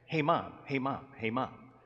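acting a pestering little boy; insistent child. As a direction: keep tugging for a parent's attention. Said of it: "he got more insistent".

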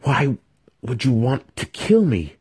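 a trapped youth pleading with his killer; desperate plea. A narrator's reading. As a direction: beg him, voice lifted. Trapped victim pleading for life.

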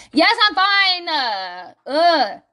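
exasperated, drawn-out retort; mock exasperation. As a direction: act out an irritated girlfriend snapping. Acts out exasperated girl's answer.

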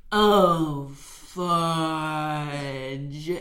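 drawn-out words, sinking low; imitated slow-motion curse; slow-motion swearing. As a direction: stretch each word out as in slow motion. Slow-motion swear quote, acted.

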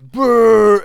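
loud mock retch; retching noise. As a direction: imitate puking with a loud gag. Imitating puking after the quote.

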